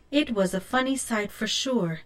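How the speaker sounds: plain reading voice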